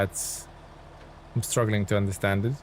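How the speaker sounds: ordinary delivery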